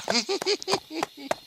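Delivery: high, giddy little cackle of glee; gleeful giggle. Acted. Ichabod gloating to his horse about the fortune, giddy high giggle. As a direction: giggle like a schemer counting his gold.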